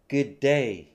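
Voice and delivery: "good day" as one unit with a single D; linked D sounds. Shown as a demonstration. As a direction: model "good day" joined, for repeating. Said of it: "これもリピートアフターミーしましょ".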